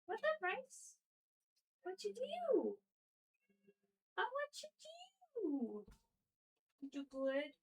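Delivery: high, soft squeaky coo. Real, not acted. Owner greeting pet Rice in baby talk.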